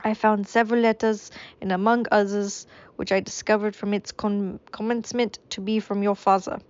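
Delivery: a wavering, hammed-up French accent; a French accent slipping away. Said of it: "I don't even think this is French anymore".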